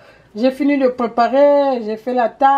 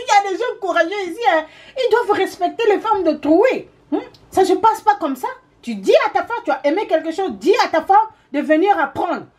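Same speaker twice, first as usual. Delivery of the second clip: husband's indignant lecture; 6 semitones higher than usual. Retold or imitated.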